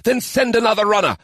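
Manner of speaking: high firm order; demanding. A narrator's reading. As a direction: order it at once. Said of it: "Sajay demanded".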